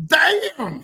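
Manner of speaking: high loud amazed exclamation; amazed damn. Real, not acted. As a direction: blurt it out in awe. Sniffs the fresh car.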